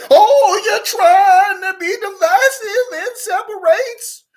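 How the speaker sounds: high, loud, slow mock outrage; mocking outraged voice. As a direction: ham up the offended accuser. Mocking voice of other side.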